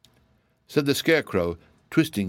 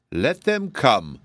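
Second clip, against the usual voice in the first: slow, higher scornful dismissal; 4 semitones higher, much slower than usual. A narrator's reading. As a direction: drag it out with scorn. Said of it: "with an accent of scorn".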